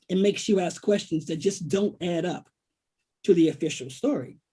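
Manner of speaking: normal talking voice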